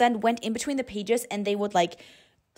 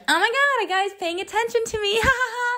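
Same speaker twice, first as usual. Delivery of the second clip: much higher, giggly fangirl squeal; giddy mock swoon; 14 semitones higher than usual. Girl gushing that a guy notices her, high giddy giggle. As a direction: gush like a flattered girl. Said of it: "You are like".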